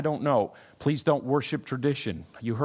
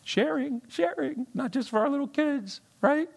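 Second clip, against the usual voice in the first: mock childish; 12 semitones higher than usual. Pastor teasing the congregation in a high kid-talk voice. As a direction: say it like talking to toddlers.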